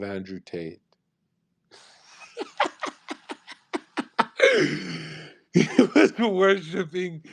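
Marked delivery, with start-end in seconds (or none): 2.4-5.2 long high-pitched giggle. Real, not acted. Laughing at his Sneako joke. 5.5-7.2 higher, slow, still giggling; laughing through words. Real, not acted. Still laughing after the joke.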